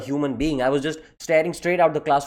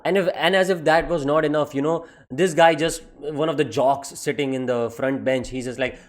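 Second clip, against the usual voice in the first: normal talking voice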